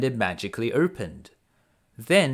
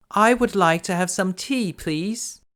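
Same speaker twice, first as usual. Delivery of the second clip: a hint of a higher, polite young woman; Beauty answering politely, a touch higher; lightly voiced Beauty; 6 semitones higher, 4 dB louder than usual. A narrator's reading. Beauty politely answers the beast's offer, lightly voiced. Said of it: "answered Beauty".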